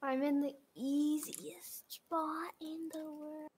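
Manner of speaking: slow hushed whisper. Real, not acted. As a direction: whisper so the seeker won't hear. Hiding from seeker.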